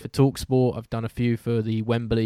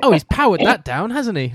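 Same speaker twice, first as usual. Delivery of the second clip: higher, louder hyped commentator call, rising at the end; 8 semitones higher, 6 dB louder than usual. Retold or imitated. Sending up his own commentary to a fellow broadcaster, excited call. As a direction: belt it like a TV play-by-play man. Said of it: "throw in a bit too much of maybe the American vernacular".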